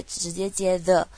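ordinary talk, nothing marked

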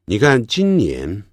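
normal talking voice

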